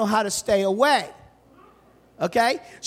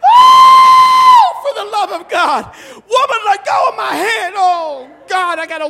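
much louder and higher, laughing, frantic cry of disgust; exaggerated panic; 9 semitones higher, 11 dB louder, slower than usual. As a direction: shout it in comic horror through laughter. Inner panic, hand held.

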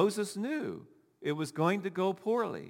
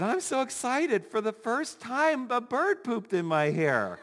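imitating excited Maria; 7 semitones higher than usual. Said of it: "And she said".

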